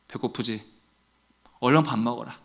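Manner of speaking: very soft, low motherly murmur; tender mother. Acted. Mother feeds her returning son, softly and tenderly. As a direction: say it gently, holding back tears.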